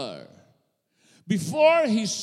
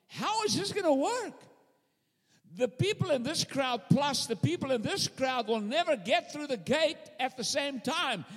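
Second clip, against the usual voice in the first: wondering guards; 6 semitones higher, 3 dB quieter than usual. Retold or imitated.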